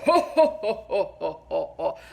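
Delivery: deep, rolling ho-ho-ho; mock a jolly Santa chuckle; jolly Santa laugh. Shown as a demonstration. Wife showing how her husband's laugh didn't sound, mock jolly. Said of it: "this isn't some, like, jolly".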